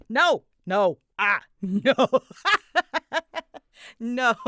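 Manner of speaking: ordinary delivery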